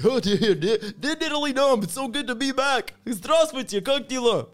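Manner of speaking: loud, very high gushing; mock overjoyed. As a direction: squeal with hammed-up delight at being back. Acts gushing joy at returning home.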